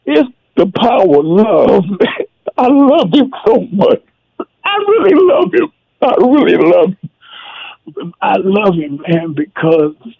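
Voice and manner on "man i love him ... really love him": real tears breaking through the words; choked up, crying. Real, not acted. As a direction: voice cracking high with tears. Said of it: "I'm shedding a tear now".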